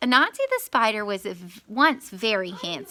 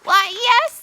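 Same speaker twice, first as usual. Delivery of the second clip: high strained yelp; 12 semitones higher, 9 dB louder than usual. A narrator's reading. Anansi bluffs in pain.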